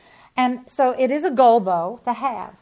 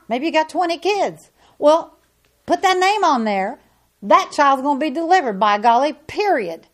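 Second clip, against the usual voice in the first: high, loud preacher's cry; full-force preaching; 4 semitones higher, 4 dB louder than usual. Acted. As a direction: drive it home from the pulpit, much higher and louder.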